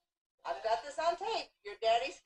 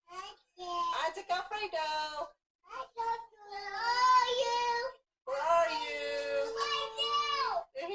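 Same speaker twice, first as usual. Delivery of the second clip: high, slow, drawn-out call; lilting high calling, very slow; sing-song call; 9 semitones higher, much slower than usual. Real, not acted. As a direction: sing it out to coax the cat out.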